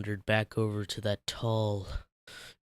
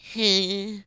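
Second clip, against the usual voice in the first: short high laugh; a quick sheepish giggle; 11 semitones higher, 6 dB louder than usual. Acted. SpongeBob laughing at Patrick, high sheepish giggle.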